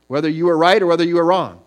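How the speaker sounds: preaching emphasis; emphatic. Real, not acted. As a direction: drive the point home.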